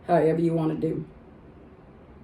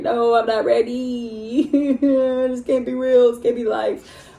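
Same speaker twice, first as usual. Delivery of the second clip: mock crying; 6 semitones higher, 4 dB louder, slower than usual. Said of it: "I was like".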